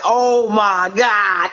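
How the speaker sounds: very slow, drawn-out amazement; retold awe. Traveler sees snow for the first time, awed. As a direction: stretch each word in wonder.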